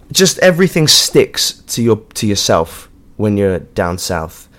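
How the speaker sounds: ordinary delivery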